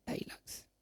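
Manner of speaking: voiceless hint of the answer; whispered answer. Shown as a demonstration. Teacher whispering the answer to the class.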